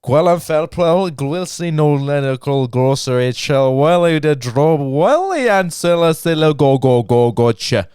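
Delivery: slow, laboured mock-Welsh reading; attempted Welsh accent. Guest tackling a long Welsh place name, mock Welsh accent. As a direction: sound out gibberish in a rolling Welsh lilt. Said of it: "getting into the Welsh accent".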